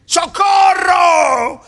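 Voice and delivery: drawn-out cry of help me; shouting for help. Acted.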